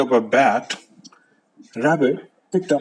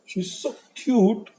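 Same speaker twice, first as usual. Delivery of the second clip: plain reading voice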